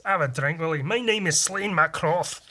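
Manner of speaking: broad Scottish brogue, rising in pitch; hammed-up Scottish accent. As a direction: announce it as a stage Scot. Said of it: "Or if it was Scottish".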